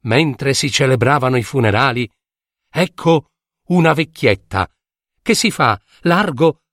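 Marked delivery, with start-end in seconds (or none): none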